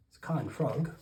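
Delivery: ordinary delivery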